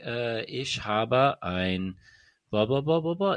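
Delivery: mock learner shy of a full German accent; half-committed German accent. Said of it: "Americans speak German, and they don't wanna, like, go all the way".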